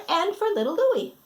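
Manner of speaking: ordinary delivery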